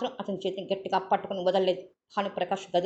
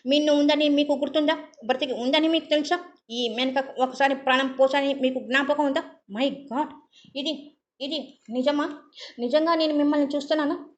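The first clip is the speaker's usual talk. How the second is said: higher and louder, feverish emotional pleading; agitated outburst; 6 semitones higher, 5 dB louder than usual. Acted. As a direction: confront him as if quarrelling, overwhelmed. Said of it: "రెట్టింపు ఆవేశంతో అతనితో పోట్లాడుతున్నట్టుగా".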